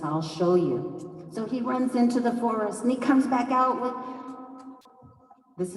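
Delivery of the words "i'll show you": lower, slow assurance; slow, grounded promise; calm confident Raven. Acted. Raven promising Hawk to show him, low and slow. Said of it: "And Raven says".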